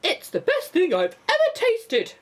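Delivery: high, loud, delighted voice; delighted character. A narrator's reading. Crew member praising the peach, high delighted gush. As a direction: gush over the taste.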